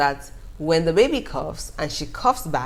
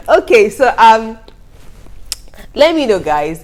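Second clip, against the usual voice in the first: much louder, addressing viewers; loud call out; 5 semitones higher, 10 dB louder than usual. Real, not acted. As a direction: raise your voice to the audience.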